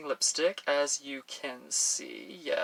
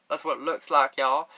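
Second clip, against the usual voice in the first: put-on Texas accent; 4 dB louder than usual. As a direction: playfully lean into a Texas twang. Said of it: "that's not my real accent".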